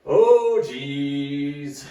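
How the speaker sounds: 크게 내뱉는 탄식; loud groan-like oh. Real, not acted. Reacts before starting the set.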